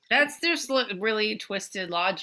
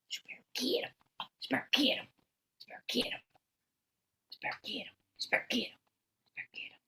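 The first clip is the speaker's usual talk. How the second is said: whispered urging; 3 semitones higher, 10 dB quieter, much faster than usual. Real, not acted. Owner whispers to the dog, urging it to bark. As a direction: whisper to the dog, much softer.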